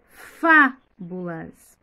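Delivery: "fábulas" slow, with loud, long "fá"; stressed syllable by syllable. Shown as a demonstration. Teacher showing children the strong syllable, "fábulas" split with punched "fá". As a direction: hit "fá" hard, then separate "bu-las". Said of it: "a sílaba pronunciada com mais força".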